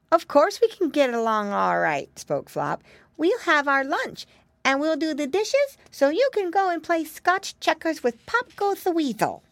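put-on high-pitched little piggy child voice; cheerful piggy boy.

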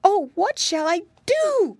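put-on high little girl's distressed cry; calling out in dismay. A narrator's reading. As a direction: call it out high, at a loss. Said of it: "called the little girl".

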